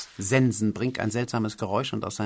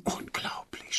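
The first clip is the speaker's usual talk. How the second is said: softer, breathy whispered wonder; amazed; 11 dB quieter, slower than usual. Acted. Producer marvels at the writer, in a hushed astonished whisper. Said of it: "staunte er".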